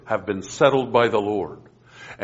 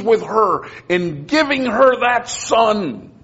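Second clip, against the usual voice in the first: higher, building preacher's fervour; rising preaching; 6 semitones higher than usual. Real, not acted. Preacher stirring the class about Hannah, climbing in fervour. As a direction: climb steadily as the sermon lifts.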